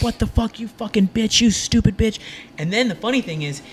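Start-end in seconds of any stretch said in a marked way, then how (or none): 0.0-2.2 worked-up rant without full volume; retold angry yelling. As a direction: imitate a friend flipping out and cursing. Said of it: "He's yelling in his language".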